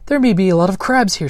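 loud, high eager cry; excited cry. A narrator's reading. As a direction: call out, thrilled by the find. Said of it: "she cried".

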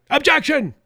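high, loud mock outcry; shouted objection. Host imitating Democrats triggered by a prayer, shouting out. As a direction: shout it out like an outraged heckler. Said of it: "people started shouting out".

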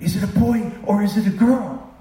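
hopeful family waiting on the news; excited question. Retold or imitated. Family at the birth asking eagerly, excited.

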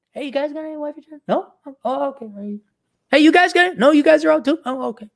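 high, fast and hopeful, then deflated; mock hopeful shopper. Vlogger playing a man asking shops for wives, high and fast. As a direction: ask around hopefully, then let it drop.

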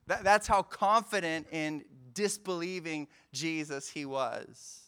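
normal talking voice

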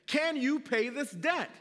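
loud, slow royal demand; stern king demanding. Acted. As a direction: demand the debt like a king on his throne. King demands payment from debtor.

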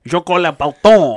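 "Jean-Claude Van Boule" said the French way, falling; "Jean-Claude Van Boule" with a French accent; French pronunciation. Retold or imitated. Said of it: "And you're like".

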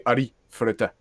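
"Ari Ferretta" with Japanese-style tapped r sounds; Japanese-style pronunciation. Shown as a demonstration. Demonstrates non-American pronunciation of name.

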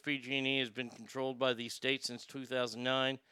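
ordinary talk

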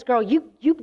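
normal talking voice

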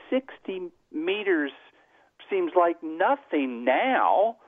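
mildly voicing the ski-jumping girl's glee; excited girl. Retelling the girl after her jump, lightly excited and high. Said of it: "she's whooping and hollering".

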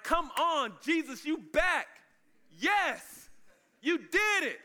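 high, loud, drawn-out cheer; overjoyed disciples welcoming him back; excited. Acted. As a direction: cheer it out high, stretching the words. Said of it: "they're excited".

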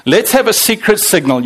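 ordinary talk

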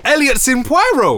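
high-pitched amazed echo of the news; real disbelief. Real, not acted. Friend learns Elliot's in Poirot, disbelieving. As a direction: blurt it out high, astonished.

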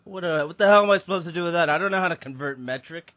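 fast, loud, high flustered complaint; throwing up hands in confusion; exasperated. Retold or imitated. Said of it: "I'm like".

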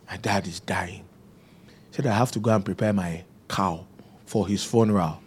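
grim hushed voice. Retold or imitated. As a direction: say it quietly at the bedside. Uncle speaking softly in ward.

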